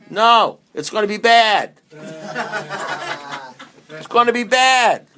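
high, slow, pressing it home; slow emphatic no, it's gonna be bad; emphatic warning. Real, not acted. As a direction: lean on each word, slow and high. Contradicting newcomer hope.

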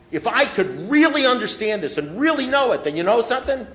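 high, loud, building self-belief; mock grandiosity. Speaker voicing his old plan to outsmart drinking, mock grandiose. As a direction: act out a know-it-all getting carried away.

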